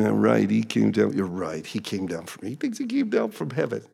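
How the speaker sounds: very quiet, sceptical muttering; imitated murmuring. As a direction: mutter it behind his back, barely audible. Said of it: "they're not saying it loudly enough".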